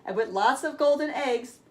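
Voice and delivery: put-on lively, bright read-aloud for kids; storybook reading. A narrator's reading. Reading Jack and the Beanstalk to children, lively storyteller voice. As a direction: tell it like a bedtime tale with big moments.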